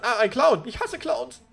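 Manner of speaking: sudden high startled cry. Real, not acted. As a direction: yelp at the sight of a clown. Sudden clown appears. (erschrockener Aufschrei)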